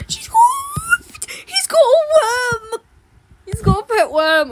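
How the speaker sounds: high squeal of discovery; delighted surprise; excited gasp. Real, not acted. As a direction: gasp and point it out.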